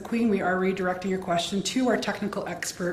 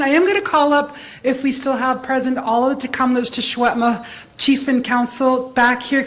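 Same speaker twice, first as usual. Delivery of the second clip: loud announcement; 2 semitones higher, 8 dB louder than usual. Real, not acted. Calling leadership up for photo.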